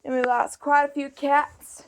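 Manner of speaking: usual speaking voice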